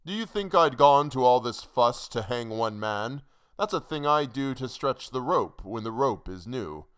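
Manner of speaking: emphatic, mocking retort; scoffing hangman. A narrator's reading. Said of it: "He laughed a laugh".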